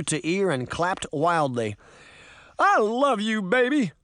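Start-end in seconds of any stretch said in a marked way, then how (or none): 2.6-3.9 high, elated shout of love; overjoyed declaration, high voice; joyful cry. A narrator's reading. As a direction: holler it to your sweetheart, pitched up. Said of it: "he cried".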